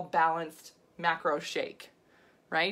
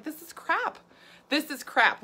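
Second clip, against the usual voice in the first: disgusted outburst; 8 semitones higher than usual. Retold or imitated. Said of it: "I was like".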